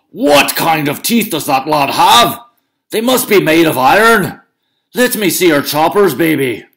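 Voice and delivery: bully giant bragging to Una, booming. Acted. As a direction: show off the flattened thunderbolt proudly. Cucullin voiced.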